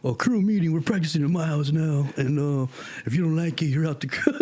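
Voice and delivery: put-on teen boss calling a crew meeting; bossy crew leader. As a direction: lay down the rules. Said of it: "now we're like".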